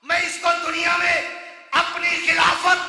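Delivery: loud, slow, chanted list of virtues; full-force preaching. Acted. Preacher listing God's gifts to man, booming chanted delivery. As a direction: hammer each word out to the crowd.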